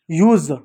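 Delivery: यूज ending in buzzing z, "yooz"; correct z ending. Shown as a demonstration. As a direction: close यूज on English Z. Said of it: "तो क्या पढ़ते हैं". (यूज़ के अंत में ज़)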